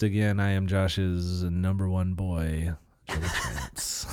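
tired, soft, low and slow; slack, worn-out delivery; lax voice. Real, not acted. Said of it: "You sound so tired all of a sudden".

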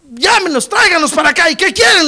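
high, loud voiced order; acted commanding call. Jesus ordering crowd to bring blind men, commanding call.

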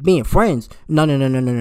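loud, incredulous no-no-no; scoffing at being called friends; loud disbelief. Real, not acted. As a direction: shoot the idea down hard.

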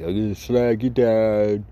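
mocking an American band faking a British accent. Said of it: "doing a slight accent thing".

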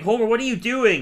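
shouting. Retold or imitated. Theo yelling at Homer.

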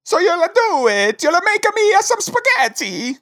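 high mock Italian accent; hopeful hammed-up Italian ghost. Ghost confirms the favor, in a high mock Italian accent. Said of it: "make me do an accent".

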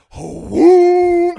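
loud, high imitated engine whoom; roaring truck noise. Storyteller imitating the truck losing its exhaust, loud whoom. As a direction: belt out the truck's sudden roar. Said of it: "all I hear is his truck go".

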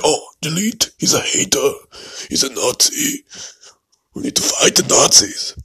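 hammed-up Schwarzenegger accent for the ADL. Podcaster mocking the ADL in a Schwarzenegger accent. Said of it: "this Schwarzenegger accent".

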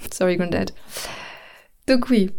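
ordinary talk

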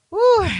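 breathy high exhale "whew". Real, not acted. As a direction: let out a high, loud whew. Recalling a steamy moment.

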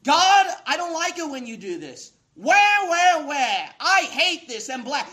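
loud, high-pitched acted complaint; mock whining. Preacher imitating believers griping at God, mock whining. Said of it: "shooting off that mouth and whining".

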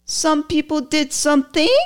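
high, incredulous mock quote; mocking echo of the congresswoman; mocking her inflection. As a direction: parrot her line back in disbelief. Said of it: "The inflection in the voice".

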